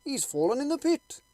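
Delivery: high, loud alarmed cry from the crowd; cried out. Acted. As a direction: shout the warning across the pit. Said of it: "cried someone".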